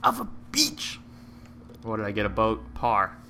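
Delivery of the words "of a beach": high, slow drawn-out mock curse. Gamer mock-cursing his golf ball, high and slow. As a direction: stretch the insult up high, playful.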